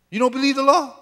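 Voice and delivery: pitched high in disbelief; incredulous challenge. Shown as a demonstration. Preacher challenging his congregation, high and incredulous.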